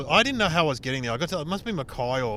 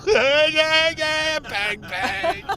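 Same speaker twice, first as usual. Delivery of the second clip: imitated banging; 13 semitones higher, 6 dB louder, much slower than usual.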